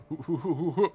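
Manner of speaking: soft run of "hoo" puffs; imitated rapid stabbing.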